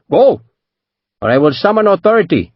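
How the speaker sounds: a hint of an insistent merchant; insist, pressing hard. A narrator's reading. Merchant threatening to call the authorities, insistent. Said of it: "Panjit insisted".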